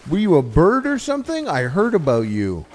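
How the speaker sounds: high, stretched-out gawking townsperson; amazed villager. Pretend. Streamer voices an imagined NPC gawking at the bird hero. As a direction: gush like a stunned NPC meeting a legend. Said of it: "no one's ever like".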